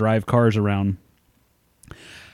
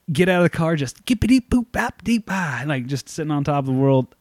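mock triumphant singsong scat, pitched up; strutting out like a champion, humming nonsense; gleeful triumph; 4 semitones higher than usual. Stepping out of the car feeling on top of the world, gleeful singsong.

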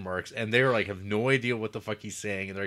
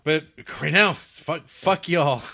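loud joking outburst, pitch up; loud teasing swear at the table; mock shout; 6 semitones higher, 10 dB louder, slower than usual. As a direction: yell it out as a playful curse.

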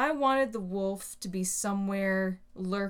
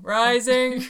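Rising pushed higher and louder; 4 semitones higher, 9 dB louder than usual. Pretend. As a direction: lift Rising up like a climbing meter. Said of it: "Simpsons reference".

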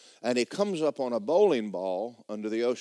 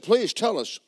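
frightened sailors; 6 semitones higher, 5 dB louder than usual. Acted. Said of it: "And they said".

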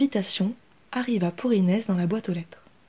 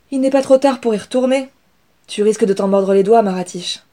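urgent urging; 2 semitones higher, 8 dB louder than usual. Acted. Parent urging her to go back.

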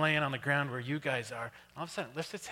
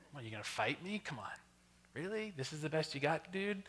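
soft, unimpressed challenge; mock taunting bear; 8 dB quieter than usual. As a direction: play a cocky bear, quietly scoffing. Imagined bear taunting the speaker.

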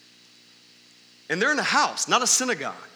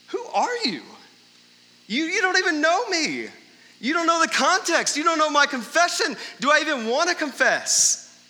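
indignant stranger; 9 semitones higher than usual. Pretend. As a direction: play the offended stranger, pitched way up. Mock reaction of stranger.

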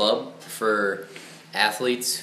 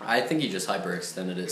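usual speaking voice

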